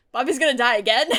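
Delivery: loud laughing shock, a bit rushed; shocked laughing disbelief. Retold or imitated. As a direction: blurt it out half-laughing in disbelief. Shocked reaction quote to show.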